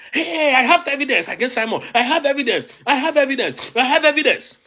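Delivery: high, hammed-up boast, half laughing; mocking the governor. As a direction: mimic a pompous governor crowing about evidence. Mocking the Enugu governor's claims.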